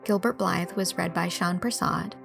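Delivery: plain reading voice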